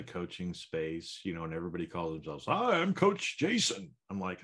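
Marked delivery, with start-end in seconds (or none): 2.5-3.9 high, slow, over-bright coach voice; cheesy coach greeting. Retold or imitated. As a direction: play a gushing self-promoting coach.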